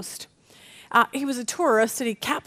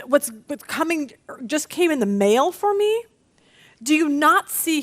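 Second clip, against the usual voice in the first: higher, insistent mock complaint; acted exasperation; 4 semitones higher than usual. Believer in a storm questioning Jesus, acted exasperation. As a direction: plead indignantly, as if he isn't paying attention.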